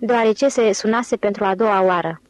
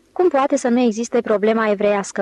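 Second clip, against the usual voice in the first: ordinary delivery, nothing marked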